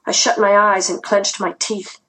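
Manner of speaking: ordinary delivery, nothing marked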